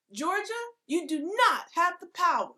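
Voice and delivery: high, loud Southern drawl from the bench; hammed-up Southern court voice. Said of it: "my southern drawl".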